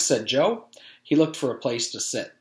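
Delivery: usual speaking voice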